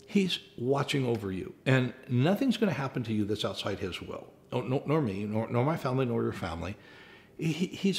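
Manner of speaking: ordinary delivery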